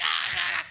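high, loud imitated guffaw; mock belly laugh. Friend laughing at the pastor, mock high laugh. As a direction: ham up a friend's laughing fit. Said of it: "he starts laughing his head off".

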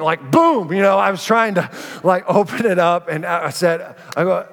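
ordinary talk

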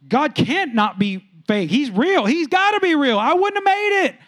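high, loud, rising exclamation; fervent testimony. Acted. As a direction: build to a loud, high cry of conviction. Acted amazed testimony looking back.

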